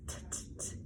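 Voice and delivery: unvoiced, clipped "t, t, t"; letter sound. Shown as a demonstration. Teacher modelling the T sound for children. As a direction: tap out the bare T sound. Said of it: "what sound does the letter T make?".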